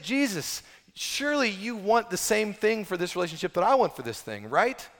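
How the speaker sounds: high, loud, pleading Paul; imitating eager Paul. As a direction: pour it out to Jesus, high and fast. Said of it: "Can you imagine Paul?".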